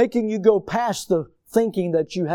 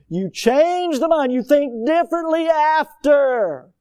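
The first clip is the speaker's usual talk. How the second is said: higher, slow, every word weighted; deliberate sermon stress; slow emphatic preaching; 9 semitones higher, 4 dB louder, slower than usual. Acted. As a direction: lay each word down slowly. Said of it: "preaching emphasis".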